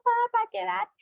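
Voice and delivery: put-on high pleading child's wail; mock crying child. Child begging father not to go, high mock wail. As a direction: beg papa to stay. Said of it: "el niño está llorando y grita".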